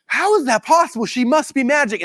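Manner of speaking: high-pitched, wonderstruck retelling; amazed disbelief. Retold or imitated. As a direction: marvel as if it were sorcery. Retells own amazed reaction.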